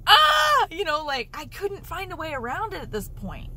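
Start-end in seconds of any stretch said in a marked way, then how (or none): 0.1-0.7 a high, loud frustrated yell, half in fun; livid yell. Retold or imitated. Annoyed at the hospital bill in her dream, a loud frustrated yell. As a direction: let out a mock furious cry, much higher and louder.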